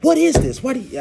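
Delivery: sudden high, loud outburst; popping off. Retold or imitated. Girlfriend popping off at the cop again, loud and high. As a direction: snap back at him, indignant. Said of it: "she pop off again".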